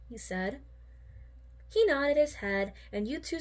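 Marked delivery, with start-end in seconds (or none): none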